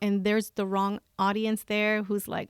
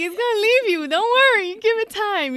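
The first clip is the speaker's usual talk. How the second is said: higher, louder mocking voice; mock reassurance; 11 semitones higher, 8 dB louder than usual. Mocking the love movies she watched, in a high sarcastic voice. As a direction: scoff at the fake love story. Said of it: "I'm like".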